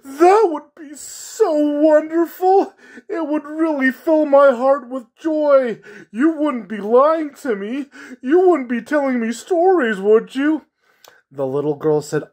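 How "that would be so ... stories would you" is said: high, loud clown voice on the edge of crying; a lonely clown begging not to be lied to; tearful clown. Acted. Clown pleads with the little girl, high tearful voice. Clown near tears, hoping.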